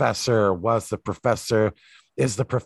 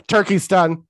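loud, higher call; announcing; 9 semitones higher, 7 dB louder than usual. Retold or imitated. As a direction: call it out like on stage. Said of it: "just be like".

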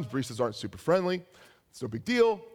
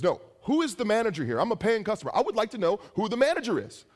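high-pitched mock indignation, demanding answers; indignant customer; 7 semitones higher than usual. Customer demanding the manager from baristas, indignant. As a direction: act out an affronted paying customer.

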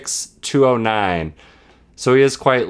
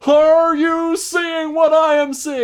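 failed Kevin Harlan; 5 dB louder than usual. Retold or imitated. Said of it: "I thought I could do it, but it turns out I can't".

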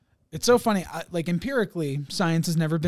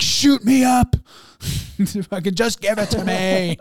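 high, loud, gleeful begging; eager; 4 semitones higher, 9 dB louder than usual. Retold or imitated. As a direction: beg for the shot gleefully. Voicing eager old-timers.

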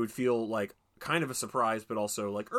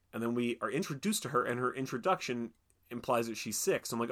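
ordinary delivery

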